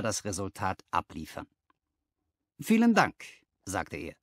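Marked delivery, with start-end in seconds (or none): 2.6-3.2 brisk employer, pitched higher, persuasive; a smooth businessman sizing up his new clerk; lightly voiced confident boss; Harry Pinner. A narrator's reading.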